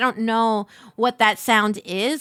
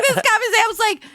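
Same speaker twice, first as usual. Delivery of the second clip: ordinary delivery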